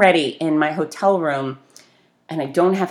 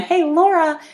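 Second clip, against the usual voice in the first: calling out; 12 semitones higher than usual. Retold or imitated. Hand up, calling at elevator.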